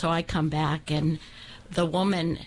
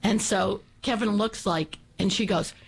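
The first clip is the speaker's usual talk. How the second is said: ordinary delivery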